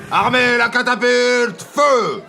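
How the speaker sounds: loud, high, slow barked order; military command. Acted. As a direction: bark it like a drill officer.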